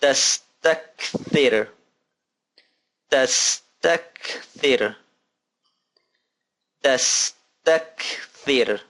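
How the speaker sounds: "Tastakthir" drawn out slowly, each syllable clear; slow model word. Shown as a demonstration. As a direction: stretch out "Tastakthir" for the class. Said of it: "model word repeated slowly".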